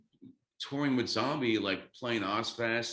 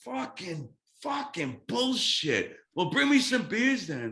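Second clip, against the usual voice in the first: high, slow mock outrage that turns louder; 10 semitones higher, 4 dB louder, slower than usual. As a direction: grumble it in a cartoonish rock-star voice, building to a demand. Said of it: "He's like".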